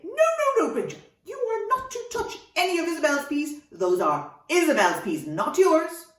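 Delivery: high, loud, emphatic telling-off; mock scolding. Mother warning the dragon off her daughter's dinner, scolding. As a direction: forbid it in a big pretend fuss.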